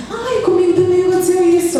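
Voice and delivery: high, light imitation of an eager child; child's voice. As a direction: mimic a child who recognizes herself. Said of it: "a criança olha pra ela e fala assim". (voz de criança imitada, aguda e animada)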